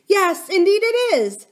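high, loud upset wail; beginning to cry. A narrator's reading. Distressed nurse admitting the rule, beginning to cry. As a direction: nurse on the verge of tears. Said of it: "beginning to cry again".

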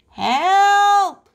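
high, loud cry for help. A narrator's reading. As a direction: yell for rescue, stretched high. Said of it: "cried Froggy".

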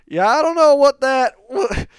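high-pitched imitation of a Southern lady caller; Arkansas lady caller. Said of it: "She's like".

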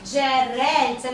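"Джерельце" stretched out syllable by syllable; slow model reading. Shown as a demonstration. Teacher repeats a pupil's word for the class, slowly and drawn out. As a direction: say "Джерельце" at half speed, drawing out the vowels.

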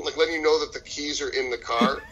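ordinary talk

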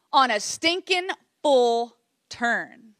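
slow, drawn-out disbelief; exasperated. Retold or imitated. Gymnast recounting a fall on the easiest skill, exasperated. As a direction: stretch out each word in mock exasperation.

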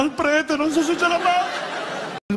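high, very slow mock agony; hammed-up dying man. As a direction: wail as if on deathbed. Sick man dramatized.

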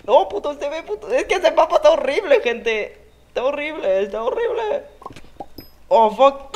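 frustrated yelling. Real, not acted.

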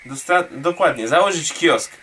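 ordinary delivery

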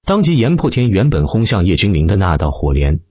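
plain reading voice

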